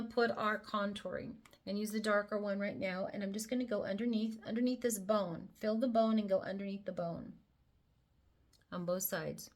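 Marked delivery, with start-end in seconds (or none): none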